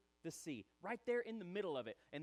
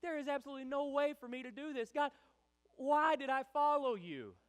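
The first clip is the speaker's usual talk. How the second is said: high, loud, despairing protest; exasperated outcry; 9 semitones higher, 9 dB louder than usual. Acted. Believer at an impossible wall, loud exasperated complaint to God. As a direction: cry out to God, slowing and louder.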